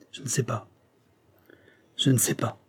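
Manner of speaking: faint, effortful words of an injured man; weak and struggling to articulate; laboured speech. A narrator's reading. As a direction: barely get the words out, very softly. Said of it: "articula l'Anglais avec effort".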